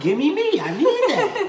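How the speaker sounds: high laughing demand. Real, not acted. Laughing demo of slang.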